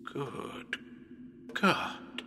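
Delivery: breathless, unvoiced gasp of horror; horrified whisper. Seeing blood on his own hand, a horrified whisper. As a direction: whisper it in shock.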